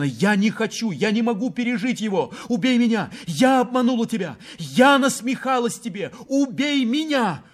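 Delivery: high, anguished, mounting cries; frantic high-pitched confession and plea; desperate pleading. A narrator's reading. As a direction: beg in despair, voice pitched high. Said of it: "просила в отчаянии Елена".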